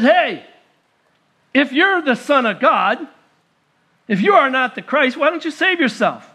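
mocking taunt. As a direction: taunt him from the cross. First criminal mocks Jesus.

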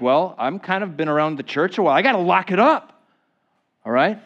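mimicking a churchgoer telling himself to toughen up; mock churchgoer. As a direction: play a tough-it-out believer. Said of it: "We think".

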